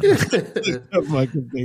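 slow, laughing repeat of That fucking bait. Real, not acted. Host laughing at his own mistake, slow and amused. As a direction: chuckle through the words, drawn out.

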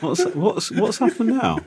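high-pitched mock-frightened questioning; scared voice. Co-host teases his partner about trouble with listeners, mock scared. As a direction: stammer it high like someone pretending to be scared. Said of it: "That's your scared voice".

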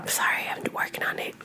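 whispered apology under her breath; sheepish whispered sorry; embarrassed whisper. Real, not acted. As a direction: whisper it like a guilty aside. Apologetic aside about cussing.

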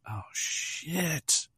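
high, soft, drawn-out dismay; mock dread. Viewer seeing Rooney's foot appear, hushed high dismay. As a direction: imitate a viewer's sinking alarm.

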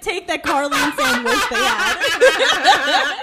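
loud, slow, through helpless laughter; words breaking up with laughter; laughing hard. Real, not acted. Co-host cracking up over a spit take, loud laughing.

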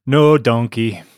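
a light Scottish accent, lightly voiced. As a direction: say it like a gruff Scot, briefly. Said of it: "does Balaam speak with a Scottish accent".

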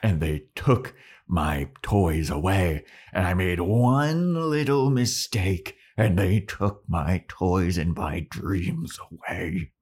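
slow, hammed-up bitter lament; aggrieved old man. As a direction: draw out every grievance melodramatically. Old man's melodramatic lament.

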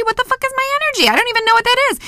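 high-pitched, baffled self-doubt; incredulous questioning. Acted. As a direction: squeak it out in mock disbelief. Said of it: "you start questioning them".

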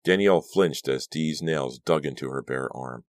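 ordinary delivery

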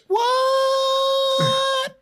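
very high, loud, drawn-out "what"; long high shriek. Retold or imitated. Host imitating Steve's entrance, long high squeal. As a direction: stretch it out at the top of your voice.

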